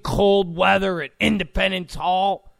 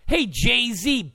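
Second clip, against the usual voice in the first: usual speaking voice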